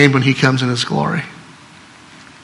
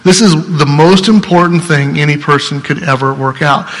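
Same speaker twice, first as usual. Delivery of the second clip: usual speaking voice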